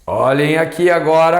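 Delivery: ordinary talk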